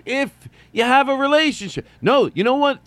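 loud, high burst; emphatic outburst. Real, not acted. Comic insists on being right, loud and high. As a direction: blurt it out insistently. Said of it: "sudden loud outburst".

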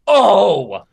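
aggressive. Retold or imitated. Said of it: "aggressively went".

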